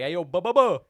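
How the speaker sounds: loud, high regular hollering his order; impatient regular shouting for cigars; customers calling out. Retold or imitated. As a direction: call out the order over the line. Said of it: "They would be like".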